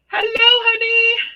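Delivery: high, loud sing-song hello; cheery greeting. Real, not acted. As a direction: greet her brightly, high.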